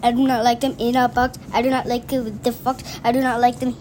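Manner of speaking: rattled off fast; rushing. Real, not acted. Child rushing through the book lines, fast rattle. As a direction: race through the list in one breath.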